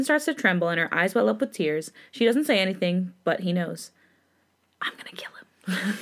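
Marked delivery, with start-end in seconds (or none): 4.8-5.9 hissed whispered threat of rage; breathless whispered vow to kill; seething whisper. Acted. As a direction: whisper it through barely held fury. Seething threat after seeing bruise.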